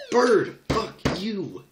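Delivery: screaming at the game, full rage; furious shout. Real, not acted. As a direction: yell it at the bird with everything. Raging at Flappy Bird game.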